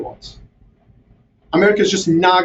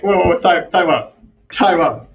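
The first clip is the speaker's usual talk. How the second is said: higher, incredulous call for time out; mock halting protest; 4 semitones higher than usual. Guest mocking America's sudden regret, mock protest. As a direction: call a halt in mock disbelief. Acted halting reaction to policymakers.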